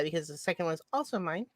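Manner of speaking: usual speaking voice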